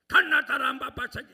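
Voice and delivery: mock goat bleat. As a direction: squeal it like a goat to ridicule his singing. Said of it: "얌생이 소리하고 염소 소리하고". (염소 울음처럼 과장한 노래 흉내)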